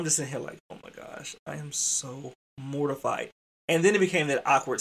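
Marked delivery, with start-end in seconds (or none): 0.7-3.3 mortified undertone. Retold or imitated. Educator to himself in awkward company, mortified and hushed. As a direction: breathe it to yourself in embarrassment. Mortified inner thought, retold.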